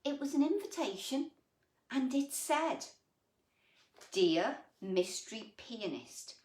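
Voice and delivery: storybook voice for little ones; gentle picture-book reading; reading to children. A narrator's reading. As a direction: read it like bedtime story time. Reads picture book aloud.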